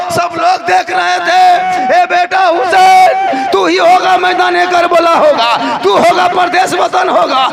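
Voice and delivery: full-force preaching. Acted. Preacher revealing Husain's fate to mourners, loud wailing. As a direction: announce the fate like a lament.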